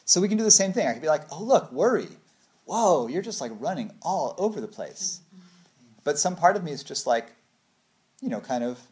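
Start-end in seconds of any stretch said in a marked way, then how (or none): 1.3-5.2 high, exclaiming wonder; mock amazement. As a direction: marvel at worry like at a kid running wild. Exclaiming at worry's wild running.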